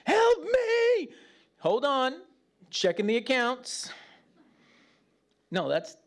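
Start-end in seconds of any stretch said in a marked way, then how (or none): 0.0-1.1 high, loud desperate cry for help; child crying for help. Retold or imitated. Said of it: "And the child's like". 1.6-3.9 higher, dismissive mock father voice; mock bureaucratic father. Father mocked checking child's record.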